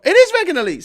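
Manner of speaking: high, loud burst of recognition; sudden excited confirmation; pleased exclamation. Real, not acted. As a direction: exclaim it, pitch leaping right up. Recognises who sent the super chat.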